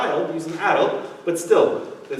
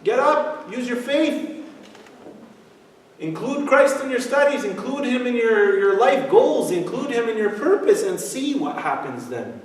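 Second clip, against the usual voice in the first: rousing exhortation; 6 semitones higher than usual. Acted. Preacher exhorting faith.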